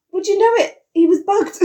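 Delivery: spring the twist with glee; excited reveal. Real, not acted. Gleeful plot reveal.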